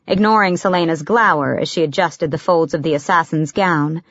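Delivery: ordinary delivery, nothing marked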